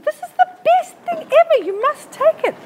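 high, loud, excited sales pitch; mock the eager saleswoman, way up high; hammed-up gushing shop assistant; gushing shop woman. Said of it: "And she said".

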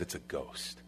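very soft, low, voiceless dread; acted hushed terror; terrified whisper. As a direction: whisper it as if frozen with fear. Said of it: "It says they were terrified".

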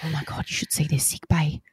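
put-on hushed, blissful child voice; dreamy kid. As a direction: sigh it like a kid in heaven. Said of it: "and then he goes".

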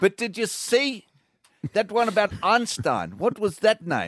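put-on South African accent. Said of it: "this accent".

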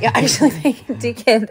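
half-laughing scold; laughing telling-off. Retold or imitated. To her toddler son, a half-laughing telling-off. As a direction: scold him while laughing. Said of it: "I was like".

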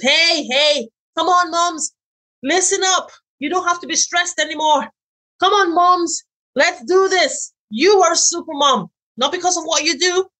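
high, upbeat rallying call. Pretend. Cheering on stressed mothers, high and upbeat. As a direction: pump up the moms.

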